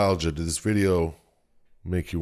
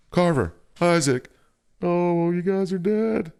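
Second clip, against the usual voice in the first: much higher, hammed-up desperate call fading to grief; 12 semitones higher than usual. Ellie calling the dead crew, much higher hammed-up despair. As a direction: call out, then realise they're gone.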